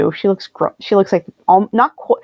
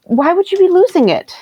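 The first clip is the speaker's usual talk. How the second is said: much higher, distressed question; alarmed questioning; 9 semitones higher than usual. Acted. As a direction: question him in rising alarm. Acted alarm at ring handover.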